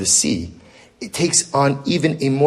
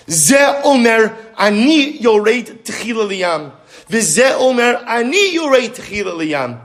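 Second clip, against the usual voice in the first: Gemara quote; 7 semitones higher, slower than usual. Acted. Tribes arguing, Gemara chanted.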